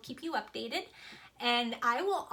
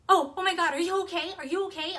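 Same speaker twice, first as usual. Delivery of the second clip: imitating mom's alarm, higher and louder; panicked; 6 semitones higher, 7 dB louder, faster than usual. Mom calling right back to check on her child, panicked. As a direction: burst out in a retold fright. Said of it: "she was in a full-blown panic".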